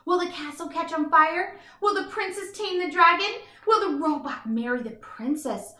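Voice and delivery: plain reading voice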